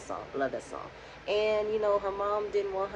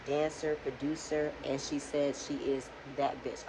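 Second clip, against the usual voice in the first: normal talking voice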